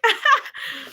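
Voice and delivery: high, short burst of laughter; real amused giggle. Real, not acted. Teasing a viewer about kisses, a high giggle.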